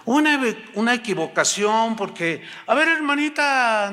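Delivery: high, slow, drawn-out imitation; conciliatory pastor. Pastor explaining an error to the congregation, placating. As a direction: stretch out an awkward public retraction. Pastor's public awkward retraction.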